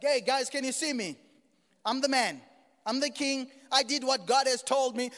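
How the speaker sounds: loud, high-pitched showing off; hammed-up imitation of a braggart; mock boasting. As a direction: call out like a self-satisfied king. Acts out boasting king.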